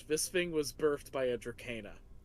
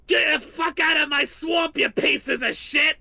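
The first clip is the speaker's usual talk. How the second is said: huge, high furious roar, much louder; monster shout; 11 semitones higher, 12 dB louder than usual. Acted. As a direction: bellow the monster's rage at the intruders. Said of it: "hear this giant monster shout".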